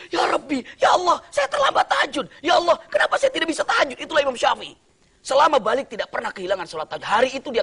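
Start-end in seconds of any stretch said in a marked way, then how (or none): 0.1-4.0 anguished cry. Acted. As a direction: cry out to God in dismay. Imam wakes late, trembling, crying out.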